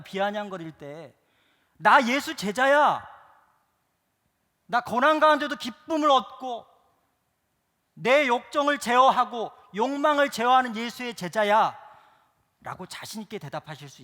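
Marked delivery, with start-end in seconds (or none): 1.8-11.8 high, loud proud claim; confident declaration. Pretend. Believer answering the mocking world, loud and proud. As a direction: shout it out proudly.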